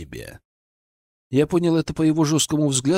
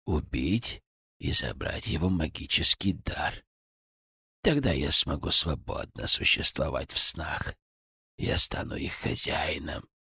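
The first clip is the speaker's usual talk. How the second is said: hollow cracked voice; 8 semitones lower, 5 dB quieter than usual. A narrator's reading. Old villain Gradov speaks. (глухо и надтреснуто, низко)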